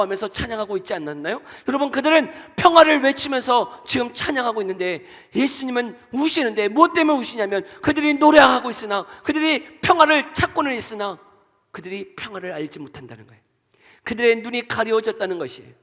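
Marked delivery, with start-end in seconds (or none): 1.7-11.2 louder and higher, pressing the point; rising sermon climax to the congregation; fervent preaching. Acted. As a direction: build to a passionate preaching climax.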